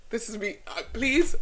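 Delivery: usual speaking voice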